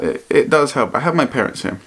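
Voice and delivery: normal talking voice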